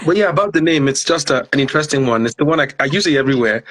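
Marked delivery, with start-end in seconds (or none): 0.0-1.0 sore jaw, mouth barely opening; talking with jaw barely open. Real, not acted. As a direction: keep the jaw nearly shut. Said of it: "I can't really open my mouth too much".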